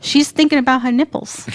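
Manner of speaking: ordinary talk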